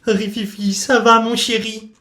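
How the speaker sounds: put-on high, slow fussing old lady. Old lady fussing over her fallen dog, high and slow. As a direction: coo over the little dog like a doting old woman. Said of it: "demanda la vieille dame".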